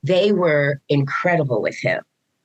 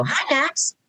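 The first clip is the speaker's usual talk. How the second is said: sing-song greeting; 10 semitones higher, 3 dB quieter than usual. Retold or imitated. Teachers greeting Max in hallway.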